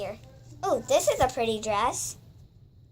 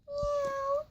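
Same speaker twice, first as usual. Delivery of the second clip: a high, thin kitten meow; playful high cat meow; 14 semitones higher than usual. Pretend. Toy cat voiced in play.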